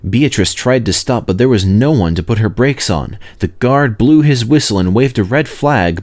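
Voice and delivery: usual speaking voice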